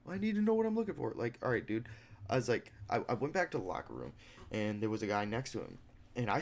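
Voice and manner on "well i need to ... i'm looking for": high, dopey mock voice of a useless desk clerk; mocking the office guy who can't help; clueless clerk impression. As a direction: play him dim and whiny, much higher. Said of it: "and he's like".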